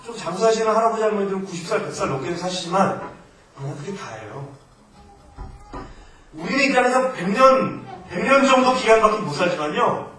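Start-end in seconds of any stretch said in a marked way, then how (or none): 6.3-10.1 loud, laughing preaching; laughing while preaching. Real, not acted. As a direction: laugh through the point about living only a hundred years. (웃으면서 크게 설교하듯)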